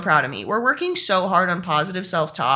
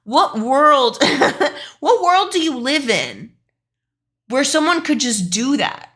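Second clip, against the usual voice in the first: incredulous retort; 4 semitones higher than usual. Retold or imitated. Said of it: "I'm like".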